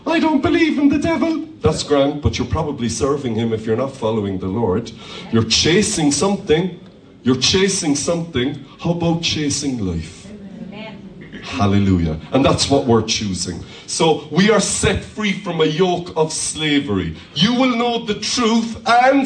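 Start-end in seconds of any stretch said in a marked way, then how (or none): 0.1-1.5 high, quick objector's voice; mock sceptic. 17.3-19.3 high-pitched call-and-response cue; rallying call. Real, not acted. Calling congregation to finish the verse.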